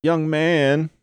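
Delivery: high whiny call to a stranger; whiny woman. A narrator's reading. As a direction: stop him with a high, drawn whine. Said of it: "the woman repeated".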